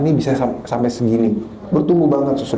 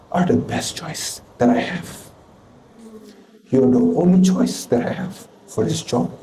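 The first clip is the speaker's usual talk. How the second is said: slow voice of God; slower than usual. Retold or imitated. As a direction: lay each word down slowly and gently. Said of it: "Dan dia bilang".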